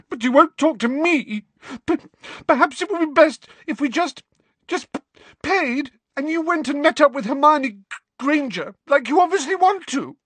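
put-on high, loud, stammering girl; shrill, tearful. A narrator's reading. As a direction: accuse him through tears. Cho upset, accusing, voiced high.

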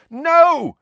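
Harry yelling to stop the fight; yelled. A narrator's reading. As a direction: shout to stop them. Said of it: "Harry yelled".

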